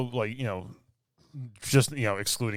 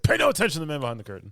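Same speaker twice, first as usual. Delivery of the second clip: rushed quote; 4 semitones higher, 4 dB louder, much faster than usual. Retold or imitated. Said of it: "It's like".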